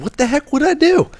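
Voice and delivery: high-pitched, incredulous retort; baffled, squeaky-high comeback; bewildered protest. Retold or imitated. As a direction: protest your innocence, voice shooting up. Retold indignant reply to a push.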